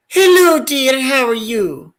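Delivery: Mrs. Doubtfire voice. Pretend. Greeting the drive-thru worker in a high, slow granny voice. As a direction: coo at the drive-thru like a doting nanny. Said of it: "I go like".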